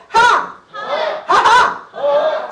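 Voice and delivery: loud, high, drawn-out ha-ha shout; big laugh. Shown as a demonstration. Laughter coach leading teachers in a big, high, loud laugh. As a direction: throw out a huge slow laugh. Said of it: "저를 따라합니다".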